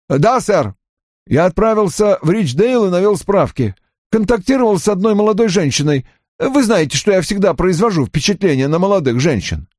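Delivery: high, eager-to-justify report; offended young subordinate reporting. Acted. Voices Johnny reporting. (высоким голосом обиженного подчинённого)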